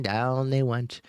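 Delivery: ordinary delivery, nothing marked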